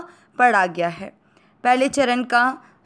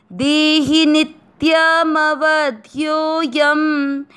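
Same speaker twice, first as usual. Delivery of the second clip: slow, raised, chanted Sanskrit verse; shloka recitation; 4 semitones higher, much slower than usual. Shown as a demonstration. Teacher recites the Gita verse for learners, slow chant. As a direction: intone the verse slowly and clearly.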